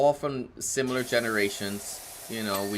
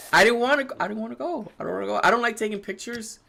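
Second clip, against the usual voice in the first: whiny; 10 semitones higher, faster than usual. Real, not acted. Whining about family photos.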